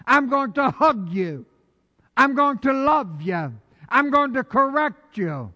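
high, loud preacher's voice voicing God; full-force preaching. Pastor preaching God's promise to the church, full force. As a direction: preach it out as God's own words.

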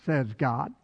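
ordinary delivery

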